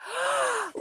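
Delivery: high sharp gasp; excited gasp. Real, not acted. Host seeing the winner appear, with an excited gasp. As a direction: gasp at the reveal.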